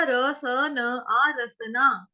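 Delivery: र स न आ very slow, sound by sound; spelled out slowly. Shown as a demonstration. Teacher splitting a word for students, slow. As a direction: break रसना into र स न आ slowly. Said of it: "तो उसमें भी क्या था".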